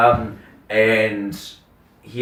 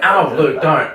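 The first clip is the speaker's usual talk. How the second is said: loud, high mock complaint; hammed-up objection to the pain; pained protest; 7 semitones higher, 7 dB louder, slower than usual. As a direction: playfully beg him to stop. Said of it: "it was that back and forth".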